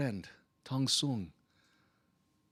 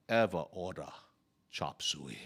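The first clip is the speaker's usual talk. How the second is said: ordinary talk, nothing marked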